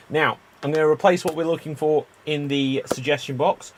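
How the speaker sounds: ordinary talk, nothing marked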